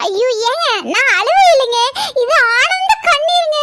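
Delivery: high, wailing happy-tears voice; hammed-up weepy delight; tearful joy. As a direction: gush through put-on tears, stretching the end. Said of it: "இப்ப எதுக்கு அழுவுற?".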